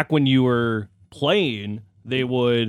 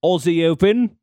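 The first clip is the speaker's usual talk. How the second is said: put-on British-tinged Australian accent, pitched up; 6 semitones higher, 6 dB louder than usual. Radio host attempting the Aussie Open, mixed British-Australian accent. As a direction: try Australian and land half British. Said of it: "what that accent sounded like".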